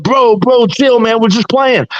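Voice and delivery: high-pitched mock placating plea; imitated backpedalling. Storyteller voicing the beaten bullies backing down, placating.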